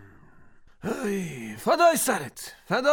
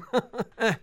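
light laugh; 2 semitones lower than usual. Acted. Wife laughs at her cooking.

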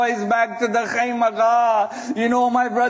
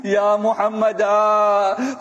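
slow wail with stretched final vowels; long, grieving calls; mourning cry; slower than usual. Acted. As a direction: call the lost sons' names in lament. Voices mother's cry for sons.

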